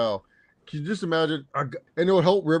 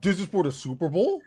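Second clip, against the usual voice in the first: higher, disbelieving question; incredulous; 4 semitones higher, 3 dB louder than usual. Retold or imitated. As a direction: repeat it in disbelief. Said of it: "What the fuck are we talking about".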